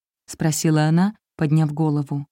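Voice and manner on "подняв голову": plain reading voice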